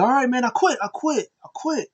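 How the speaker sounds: high-pitched imitation of giving up; desperate surrender. Brother surrendering to save his brother, imitated desperation. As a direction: give in urgently, voice jumping high.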